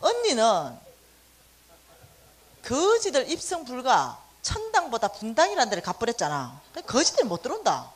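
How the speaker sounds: put-on a sister scolding her older sister, loud and teasing; bossy younger sister. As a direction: order her sister around with mock scorn. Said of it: "우리 둘째가 이렇게 말하는 거야".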